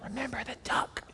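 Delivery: sly whispered threat. Retold or imitated. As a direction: hiss it as a secret reminder. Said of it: "she whispers to him".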